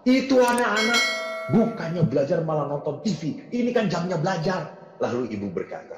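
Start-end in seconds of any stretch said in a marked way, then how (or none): 0.0-4.7 grumbling father. Retold or imitated. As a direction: complain like an irritated dad. Father complaining to mother.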